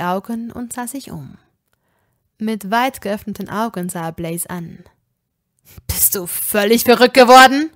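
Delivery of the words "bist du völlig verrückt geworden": loud, outraged yell; blindfolded friend dragged to a bar, yelling in outrage; furious shout. Acted. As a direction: shout it in disbelief at your friend. Draco furious at Blaze.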